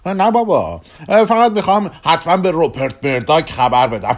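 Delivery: high, loud mock reassurance; sarcastic retort. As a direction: scoff it out, heading for a laugh. Sarcastic retort to secrecy request.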